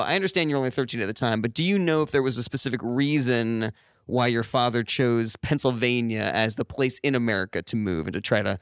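ordinary delivery

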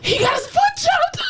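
very high cry of disbelief; real, squealed astonishment; shocked shriek. Real, not acted. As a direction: shriek it out in amazement. Shocked by news of amputation.

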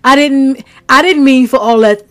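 mocking the killer. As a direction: mimic a defendant pleading innocence, hammed-up. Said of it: "And then be like".

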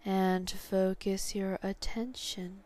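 usual speaking voice

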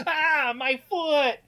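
high, loud, drawn-out yelp; imitating a bitten man; mock pain cry. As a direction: yelp slowly in hammed-up pain. Retelling man bitten on heel.

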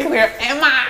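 high, loud Aussie name-calling; mock Australian shout of the names; Australian accent. Mock Australian catchphrases traded.

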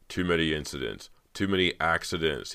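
usual speaking voice

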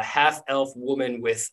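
normal talking voice

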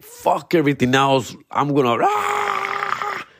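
wild rising cry that breaks into a high yell; hammed-up crowd frenzy, pitch shooting up; frenzied fan. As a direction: play a fan losing it, ending in a yelp. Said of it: "it's just more like".

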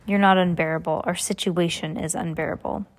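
ordinary delivery

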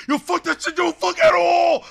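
high-pitched imitation of an argument; mock shouting, last words stretched; intense shouting. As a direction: yell it like a heated studio row. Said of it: "it sounds really intense".

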